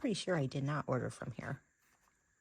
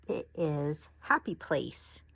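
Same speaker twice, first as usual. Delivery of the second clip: usual speaking voice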